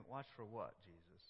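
quiet, bewildered muttering; a disciple's hushed confusion; puzzled thought. Retold or imitated. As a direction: wonder it under your breath. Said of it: "they're probably thinking".

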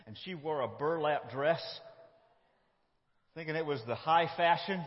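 ordinary talk, nothing marked

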